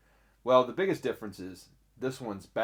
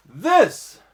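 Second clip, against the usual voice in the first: higher, much louder "this"; excited reveal; 9 semitones higher, 12 dB louder than usual. Real, not acted. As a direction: present the bag with a burst. Reveals the product.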